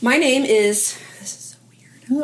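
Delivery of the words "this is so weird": hushed, half-whispered aside; self-conscious aside. Real, not acted. Speaker breaks off her introduction, finding it weird, self-conscious whisper. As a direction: drop to an embarrassed whisper.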